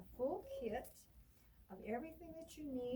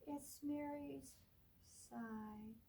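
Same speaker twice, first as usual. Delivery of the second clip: put-on reading to children; 4 dB louder, slower than usual. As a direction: read it like storytime for little ones. Librarian reads picture book aloud.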